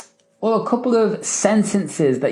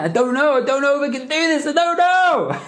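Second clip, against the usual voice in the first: hammed-up high-pitched self-doubt; rising, rushed mock despair; mock helplessness; 8 semitones higher than usual. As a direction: whine it as an exaggerated doubter, climbing higher. Hammed-up doubting client, then laughs.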